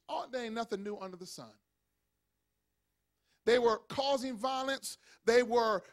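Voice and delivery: ordinary talk, nothing marked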